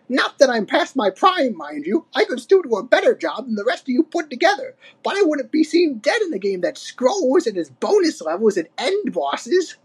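put-on high-pitched bragging old codger; Cranky, snappish. A narrator's reading. Old ape defending his pride, testy. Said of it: "snapped Cranky".

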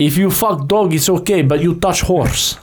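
broken-English Russian caricature; mock Russian. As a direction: state the rules like a comic Russian. Plays Russian lawmaker, broken English.

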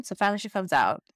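ordinary talk, nothing marked

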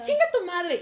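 high, loud mock outburst; acting out furious swearing; retold yelled insult. Student cursing teacher before storming out, loud mock yell. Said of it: "se estaban gritando de que".

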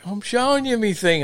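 high-pitched mock indignation; upset driver. Driver protesting to the official, high mock upset. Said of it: "he would get upset because".

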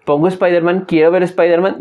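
ordinary talk, nothing marked

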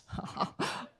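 soft breathy self-satisfied chuckle; a conceited little laugh; smug chuckle. Retold or imitated. Haman chuckles at his own importance, smug.

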